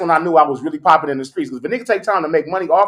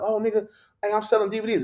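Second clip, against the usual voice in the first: higher, softer quoted pitch; imitated street seller; 7 semitones higher, 6 dB quieter than usual. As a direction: imitate a hawker, high and soft. Acting out DVD seller approaching him.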